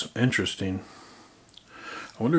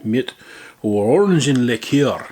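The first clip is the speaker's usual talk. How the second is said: loud German read; hammed-up German; mock German accent; 5 dB louder than usual. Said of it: "mock German product name".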